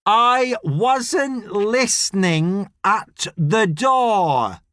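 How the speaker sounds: slow, deliberate protest of innocence; loud enunciation. A narrator's reading. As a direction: spell it out loudly, word by word. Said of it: "enunciates loudly".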